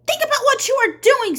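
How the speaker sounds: high, loud plea; crying out. Acted. Miss Catherine pleading loudly with the mob. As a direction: beg them to stop, at full voice. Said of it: "cried Miss Catherine".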